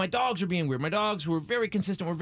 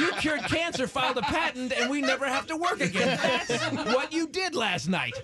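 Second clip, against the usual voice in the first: high, laughing, gushing praise; mock proud praise; 7 semitones higher than usual. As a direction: gush the good news like a thrilled wife. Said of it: "never followed by".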